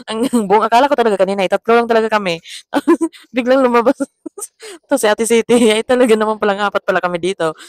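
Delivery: words bubbling out between real laughs; laughing. Real, not acted. Vlogger realising there are four of them, laughing. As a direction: try to talk through the giggles. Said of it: "tang tawa talaga ako dito".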